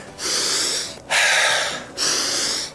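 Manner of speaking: deep, audible recovery breaths; deep breathing. Shown as a demonstration. Coach shows players how to recover, deep breathing. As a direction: breathe in through the nose, sigh it out. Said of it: "good air in through your nose".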